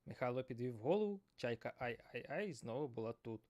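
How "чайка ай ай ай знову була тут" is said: ordinary delivery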